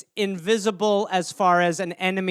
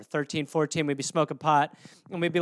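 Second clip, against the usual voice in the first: ordinary delivery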